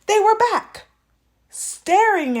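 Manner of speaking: high, loud alarm; horrified storyteller reveal; shocked reveal. A narrator's reading. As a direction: blurt the shock. Spooky reappearance.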